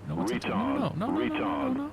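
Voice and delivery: panicking as the plane goes wrong; rising panic. Real, not acted.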